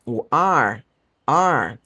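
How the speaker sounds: "are" with a long pulled rural R; drawn-out R. Shown as a demonstration. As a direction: stretch the R in "are" like a countryside speaker. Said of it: "uma pessoa do interior que puxa o R".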